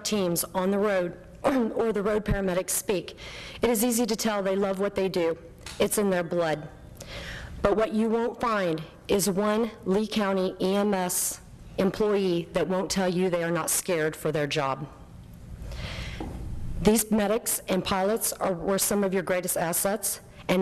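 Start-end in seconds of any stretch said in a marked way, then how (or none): none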